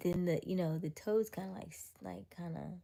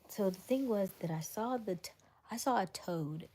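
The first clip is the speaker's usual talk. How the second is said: normal talking voice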